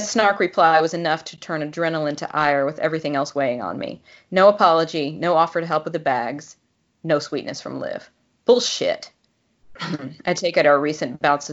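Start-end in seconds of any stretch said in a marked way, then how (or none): none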